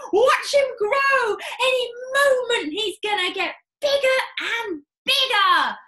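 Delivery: father crying out to watch the cockerel; excited cry. Acted. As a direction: point at the bird, thrilled. Mr Cranky cries out.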